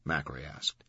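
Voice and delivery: ordinary delivery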